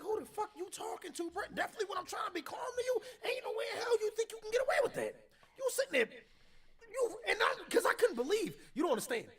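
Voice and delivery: high, loud, incredulous challenge; outraged confrontation. Retold or imitated. Retelling how he challenged a disrespectful man, outraged. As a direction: confront them in high-pitched disbelief.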